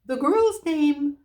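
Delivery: high, slow, loud owl voice. Acted. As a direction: shout it so the deaf dwarf hears. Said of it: "as loud as it could".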